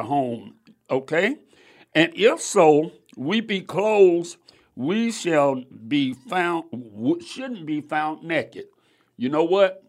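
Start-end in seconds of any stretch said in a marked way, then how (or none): 1.9-8.7 loud, slow, weighty reading; scripture reading. Acted. Preacher reading the verse aloud, loud and slow. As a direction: proclaim each phrase slowly.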